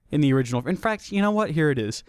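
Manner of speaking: ordinary talk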